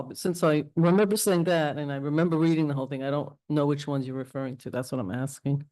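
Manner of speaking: ordinary talk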